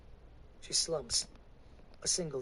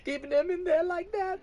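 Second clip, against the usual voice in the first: high, loud crying woman's voice; sobbing; 5 dB louder than usual. Acted. Woman sobbing to the preacher, high and loud. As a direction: sob the words out. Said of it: "she sobs".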